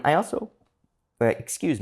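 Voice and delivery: talking through hiccups; words broken by hiccups. Real, not acted. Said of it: "I have the hiccups now".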